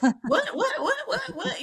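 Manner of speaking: high laughing string of whats; laughing disbelief. Real, not acted. Telling friends of her shattered hopes, laughing high disbelief. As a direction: laugh through the repeated whats.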